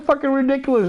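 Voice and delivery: ordinary talk, nothing marked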